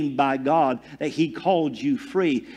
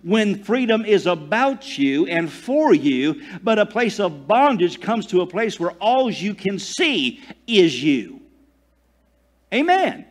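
loud, high, driving sermon voice; full-force preaching; 7 semitones higher, 6 dB louder than usual. Acted. Pastor preaching loudly to his congregation. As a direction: preach it out to the whole room.

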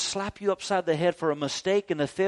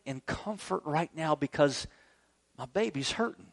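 tender God; 3 semitones lower, 9 dB quieter than usual. Retold or imitated. God comforting his hurting child, soft and tender. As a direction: speak like a father cradling a hurting child. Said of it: "He's saying".

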